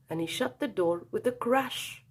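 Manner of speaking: usual speaking voice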